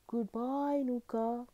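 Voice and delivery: put-on high farewell call of young friends; crying out goodbye. A narrator's reading. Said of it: "cried Mickey and Ceci".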